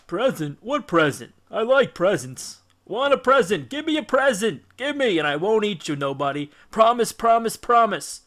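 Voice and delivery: put-on a giant child begging for a gift; greedy childish Cyclops. A narrator's reading. Cyclops begging Odysseus for his present, greedy and childish.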